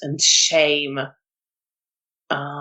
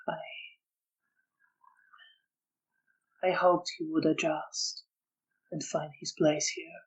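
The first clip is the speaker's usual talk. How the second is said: guilt-ridden man, regretful; 10 dB quieter than usual. Acted. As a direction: confide the regret softly.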